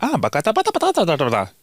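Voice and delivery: rattled-off imitation European accent; generic European accent. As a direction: babble like a generic European speaker. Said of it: "generic European accent".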